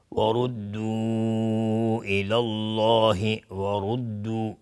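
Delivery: slow, drawn-out recitation with long vowels; Quran recitation. Shown as a demonstration. Teacher reciting the verse being taught, slow Quran recitation.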